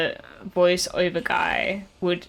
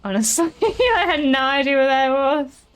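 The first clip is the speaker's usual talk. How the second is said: laughing; 7 semitones higher, 4 dB louder than usual. Real, not acted. Laughing at confusing game moment.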